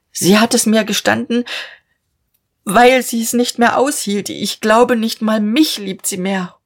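a father pleading, close to tears; holding back sobs. A narrator's reading. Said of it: "nur noch das Schluchzen unterdrücken". (mit unterdrücktem Schluchzen)

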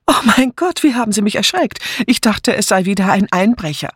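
high, flustered exclamation; startled woman. A narrator's reading. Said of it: "Sie stieß einen schwachen Schrei aus". (erschrocken, mit hoher Frauenstimme)

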